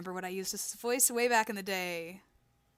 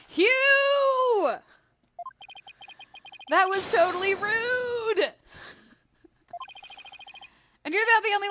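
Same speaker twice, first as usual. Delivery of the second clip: high, loud battle cry; indignant yelling; 12 semitones higher, 4 dB louder than usual. Acted. Young lawyer yelling after the rude rival, indignant and determined. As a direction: holler it after them, stretching the big words.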